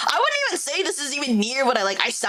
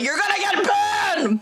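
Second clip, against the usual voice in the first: mock shout; 8 semitones higher, slower than usual. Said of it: "I love how you're like".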